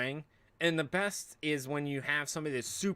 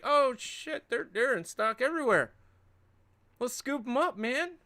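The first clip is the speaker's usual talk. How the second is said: much higher, excited dude voice; mock surprise; 11 semitones higher, 3 dB louder than usual. Streamer imitates buyer finding stock everywhere, high excited. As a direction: gasp then rally the crew.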